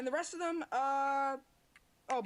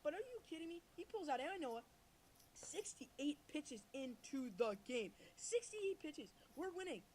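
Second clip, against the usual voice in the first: higher, fired-up rant at the team manager; exasperated rant; 7 semitones higher than usual. Acted. Friend dares him to rant about the Phillies manager, high exasperated rant. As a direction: vent at full steam, voice climbing high. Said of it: "Let's see that rant".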